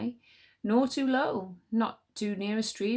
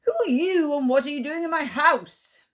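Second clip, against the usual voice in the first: high, loud furious challenge; angry tiger; 8 semitones higher, 8 dB louder than usual. Acted. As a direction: roar at the intruder. Tiger confronts stag.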